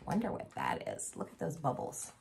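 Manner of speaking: soft secret whisper; hushed whisper. Acted. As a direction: whisper it to the kids. Reader hushes over picture.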